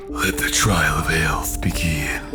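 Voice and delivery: eerie whisper. Acted. Voicing the cave beast.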